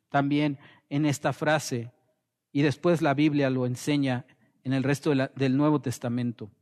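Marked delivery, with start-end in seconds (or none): none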